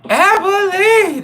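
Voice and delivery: long, high, drawn-out falsetto shout; flamboyant call. Retold or imitated. Inmates catcall shirtless narrator, long high falsetto. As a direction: holler it at the shirtless guy. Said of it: "and they be like".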